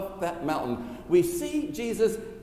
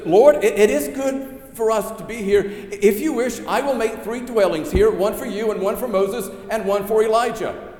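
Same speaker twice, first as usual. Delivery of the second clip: raised, louder, eager plea; eager Peter; 6 semitones higher, 7 dB louder than usual. Acted. Peter blurting to Jesus on the mountain, eager and raised. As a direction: let Peter blurt it out in wonder.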